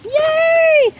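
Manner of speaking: loud high cheer; excited yay. Real, not acted. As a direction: cheer loudly. Celebrating dog.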